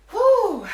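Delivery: puffed-out exhale; breathy whew. Real, not acted. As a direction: blow out hard after effort. Exhale during workout.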